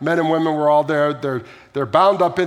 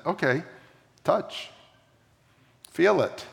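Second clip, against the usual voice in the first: gentle invitation; 5 dB quieter than usual. Retold or imitated. Jesus invites Thomas gently.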